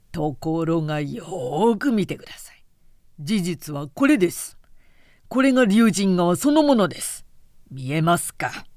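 hoarse guide pressing his point. A narrator's reading. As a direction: rasp it out, building to an emphatic reveal. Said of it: "久保大六がしゃがれ声で言った". (しゃがれ声で力説する)

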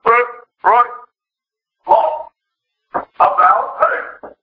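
barked drill calls with pauses between; military drill commands. Acted. Preacher acting out repentance as a military term, drill commands. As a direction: call out marching orders like a drill instructor. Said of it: "is a military term".

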